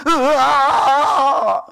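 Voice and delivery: long, drawn-out crying yell; acted scream. Preacher acting Jehoshaphat crying out to his father, screaming. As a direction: wail out a wordless cry for help. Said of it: "아들이 소리를 지르면".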